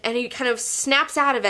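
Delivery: normal talking voice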